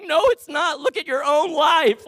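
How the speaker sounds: loud, high-pitched comic wail; mock crying. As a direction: wail it in hammed-up despair. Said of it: "And I'm crying".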